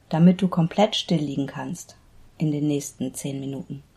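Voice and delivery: measured meditation guide with long pauses; unhurried Yoga Nidra instruction, pausing between body parts; guided meditation. Acted. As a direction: lead them into relaxation, phrase by phrase.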